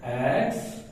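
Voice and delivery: "Extraordinary" with very long silences between syllables; isolated syllables. Shown as a demonstration. Teacher writing out the syllables of a big word, widely spaced. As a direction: dictate "extraordinary" one syllable at a time. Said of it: "इसका syllable बनाता हूं".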